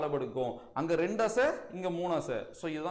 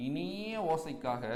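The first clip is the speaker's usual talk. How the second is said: drawn-out dictation; 4 dB quieter, much slower than usual. Shown as a demonstration. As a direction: stretch out இனிய with a long middle vowel. Stresses the sweet sound in dictation. (இனிய என்பதை நீட்டி மெதுவாகச் சொல்லுதல்)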